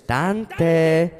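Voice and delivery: drawn-out, level call of DANTE; unhurried motherly name call; imitating mother calling. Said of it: "on her tone. She would say".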